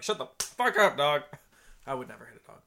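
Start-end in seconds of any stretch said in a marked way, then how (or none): 0.0-1.2 mock angry yell. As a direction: act out snapping at a pet. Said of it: "I'd be like".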